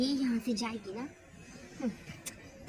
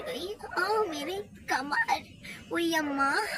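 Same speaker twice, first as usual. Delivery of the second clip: mock pained groan; 6 semitones higher, much slower than usual. Villain hurt back after fall.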